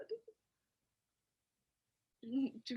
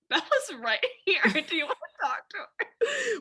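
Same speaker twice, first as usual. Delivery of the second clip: giggling through a slow, higher quote; laughing mock Jacob; 7 semitones higher, 5 dB louder than usual. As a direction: laugh while quoting him slowly. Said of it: "Or he could have said".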